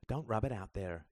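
ordinary delivery, nothing marked

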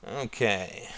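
soft, drawn-out, thoughtful start; musing. A narrator's reading. Justin starting to think up video ideas, musing. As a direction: ease into thinking aloud, quietly. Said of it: "mused Justin".

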